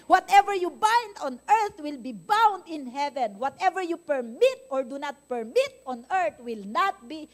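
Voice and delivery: slow, loud preaching declaration. Acted. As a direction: proclaim scripture to the congregation. (mabagal at malakas na pangangaral)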